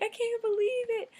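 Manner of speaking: high, loud imitation of an amazed friend; overjoyed disbelief. Friend learning her lost dog is found, imitated overjoyed squeal.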